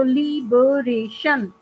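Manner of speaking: Liberation slowly as ली-ब-रे-शन; syllable by syllable. Shown as a demonstration. Teacher breaking the word up for students, syllable by syllable. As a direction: split liberation into four distinct beats. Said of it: "four syllables आ रहे हैं".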